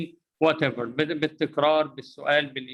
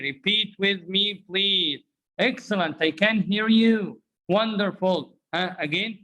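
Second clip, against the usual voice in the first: normal talking voice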